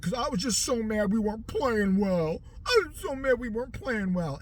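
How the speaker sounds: mock sulky athlete; lightly voiced pouting player; whiny excuse. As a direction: whine the excuse like a sulking player. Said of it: "He's like".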